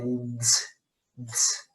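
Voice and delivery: "ds" suave y breve, como una d pegada a una s; a soft short d melting into s; sonido ds. Shown as a demonstration. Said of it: "como una D S". ("ds" susurrado, d y s unidas)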